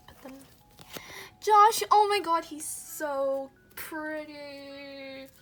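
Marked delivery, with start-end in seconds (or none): 1.4-5.3 gushing. Real, not acted. Gushing over Joshua card.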